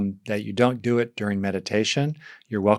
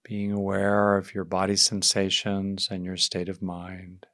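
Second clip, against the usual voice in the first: guided meditation. Real, not acted. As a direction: ease them gently into settling, leaving space between phrases.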